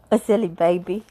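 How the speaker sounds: usual speaking voice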